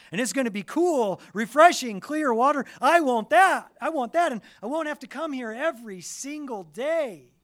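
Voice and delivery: high, loud, fast and breathless eagerness; eager excitement. Pretend. Preacher voicing the woman's eager longing for the water, loud and high. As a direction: gush like you've found the perfect deal.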